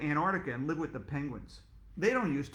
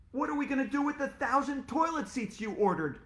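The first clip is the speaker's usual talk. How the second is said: alarmed shout; 9 semitones higher than usual. Acted. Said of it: "shouted Mister Klutz".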